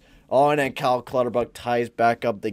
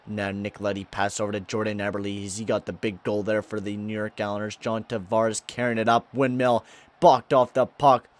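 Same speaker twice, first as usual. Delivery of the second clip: lively sportscaster calling the action; play-by-play; 4 semitones higher, 6 dB louder than usual. Acted. Gamer calling his hockey game live like a TV announcer. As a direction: call the play like a broadcaster.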